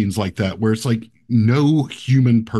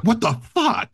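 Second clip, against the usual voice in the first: mock shock; 12 semitones higher than usual. Man seeing a ghost kid in his room, high mock shock. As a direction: play at being freaked out, voice leaping up.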